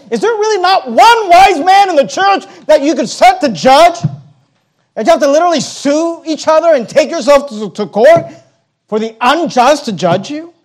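loud, high rebuking preach; fiery preaching. Acted. Pastor voicing Paul rebuking the church, loud and high.

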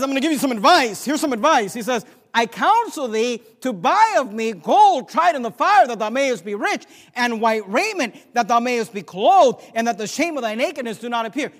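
forceful preaching. Acted. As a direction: thunder the counsel out, stretching the verse. Preaches scripture at full force.

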